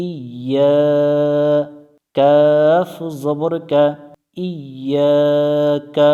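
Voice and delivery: slow, stretched spelling-out of ইয়াকা; drawn-out syllables. Shown as a demonstration. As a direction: spell it out slowly, each sound stretched. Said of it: "ইয়ার তাসদিদটা উচ্চারণ করি না".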